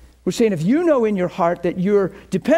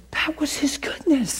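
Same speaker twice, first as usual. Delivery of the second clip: breathy, hushed revelation; hushed whisper; 4 semitones higher, 4 dB quieter than usual. Real, not acted. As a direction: drop to a reverent whisper. Preacher reveals God's voice tenderly.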